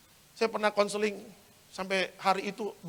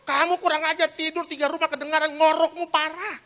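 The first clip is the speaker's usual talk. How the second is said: higher-pitched, mildly hammed-up nagging; mock scolding; 11 semitones higher, 7 dB louder than usual. Spouse complaining about the other's snoring, mock scolding. Acted quarrel between spouses.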